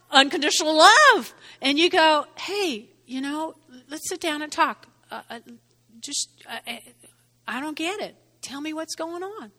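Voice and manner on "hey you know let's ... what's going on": soft, patient coaxing; gentle invitation. Acted. Asking someone to sit down and talk, gentle invitation. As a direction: quietly invite them to open up.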